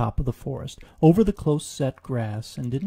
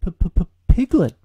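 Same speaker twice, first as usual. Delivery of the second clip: higher, louder stammer on the name; stammering fright; 6 semitones higher, 11 dB louder than usual. Acted. Frightened Piglet answering a voice in the dark, stammering. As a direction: trip over the P in fear.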